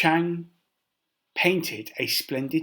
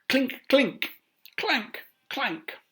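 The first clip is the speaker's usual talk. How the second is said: chain clinking sounds; 9 semitones higher, slower than usual. Acted.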